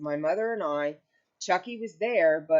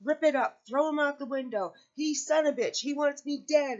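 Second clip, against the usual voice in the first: imitating an upset rant, pitched much higher; mock the raging outburst, high and agitated; angry ranting quote; 9 semitones higher than usual. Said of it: "and he'd say".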